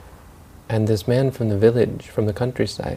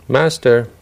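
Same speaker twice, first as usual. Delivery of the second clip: raised-voice appeal to a master; calling out; 3 semitones higher, 7 dB louder than usual. Retold or imitated. City man hails the rich man, calling out. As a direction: call out "master", louder and firm.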